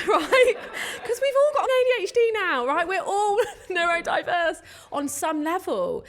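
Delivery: a real laugh breaking through tears; laughing, quick and bright. Real, not acted. Laughing at her own joke.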